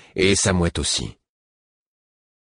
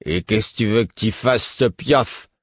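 voix d'ivrogne; 3 semitones higher than usual. A narrator's reading. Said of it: "d'une voix d'ivrogne".

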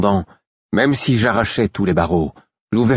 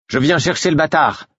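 raised-pitch announcement; announcing; 8 semitones higher than usual. A narrator's reading. Guard strides in to fetch the prisoner, announcing it. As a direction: announce your errand in a higher voice. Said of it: "entra à grands pas en annonçant".

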